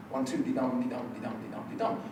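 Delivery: "dee-dum, dee-dum" tapped out soft and even, weak-strong; iambic rhythm. Shown as a demonstration. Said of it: "structured in the iambic pentameter".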